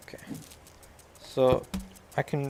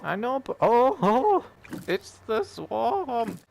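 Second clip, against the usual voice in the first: alarmed dismay; 9 semitones higher, slower than usual. Real, not acted. Swarm closes in on player.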